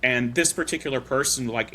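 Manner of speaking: normal talking voice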